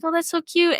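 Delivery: high, cooing retold reaction; gushing. Smitten reply in retold myth.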